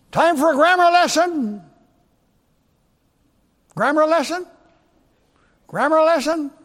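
loud, high announcement; calling out. Real, not acted. Preacher hailing his congregation for a lesson, calling out. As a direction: call it out high and loud to the room.

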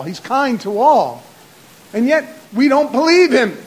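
mounting sermon fervor; preaching. Acted. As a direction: press the point to everyone.

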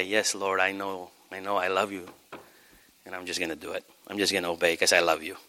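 low, hushed prayerful yielding; tender surrender. Acted. Believer saying yes to the Lord, low and tender. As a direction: answer low and intimate, giving in lovingly.

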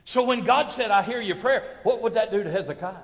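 loud, high preacher's delivery; fired-up preaching. Real, not acted. Preacher rousing the congregation about Hezekiah's prayer, fired-up preaching. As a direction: preach it out with force.

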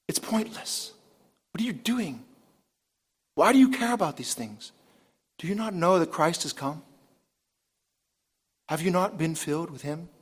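soft, near-whispered appeal; hushed pleading. Acted. Preacher voicing Paul to believers who cling to rules, hushed pleading. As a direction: drop to a hush and question them earnestly.

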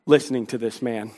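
muttering under the breath like a bored congregant; mumbling bored listener. Retold or imitated. Preacher voicing a bored listener grumbling at him, muttering low. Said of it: "A lot of people in here are like".

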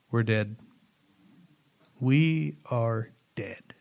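low, hushed voice of dawning doom; doomed deadpan. Acted. Preacher imagines Jericho watching from the walls, doomed deadpan. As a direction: say it low and soft, slowing right down.

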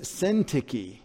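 Syntyche as SIN-ticky; mistaken English reading. Shown as a demonstration. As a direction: say Syntyche anglicized, ending in "kee". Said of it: "and you think it's Sint".

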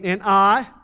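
"I" hit loud and held for the crowd to answer; a loud cue word "I" for a call and response; stress on I. Shown as a demonstration. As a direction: punch "I" and pause so they can shout God. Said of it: "as soon as I say, "I," I want you to say "God".

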